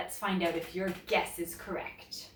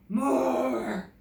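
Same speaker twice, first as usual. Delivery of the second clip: louder huffed demand from a dinosaur; huffing dinosaur; 4 semitones higher, 5 dB louder than usual. A narrator's reading. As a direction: huff it like a hungry beast. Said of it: "huffs Grizzle".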